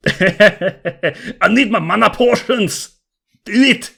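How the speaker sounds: high, slow laughing mock yell; Scottish shout. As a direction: ham it up as a tipsy warrior. Joins the [name] impression.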